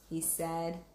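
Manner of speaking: usual speaking voice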